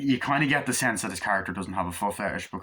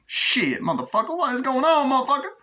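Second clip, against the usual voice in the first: much higher, loud hammed-up tough guy; mock gangster yell; 5 dB louder than usual. Acted gangster dialogue from movie.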